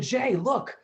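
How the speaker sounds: high, mock excited brother; selling the prank with fake wonder; sly big brother. Brother showing Jay broken glass, mock excited.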